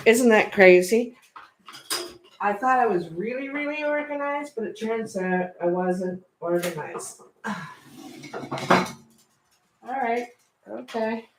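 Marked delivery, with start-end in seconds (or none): none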